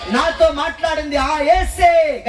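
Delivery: slow, high, shouted declaration; loud proclamation. Retold or imitated. Father telling everyone it was Jesus, slow high shout. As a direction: proclaim it slowly at full voice. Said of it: "గట్టిగా స్తోత్రం చెప్పాలని".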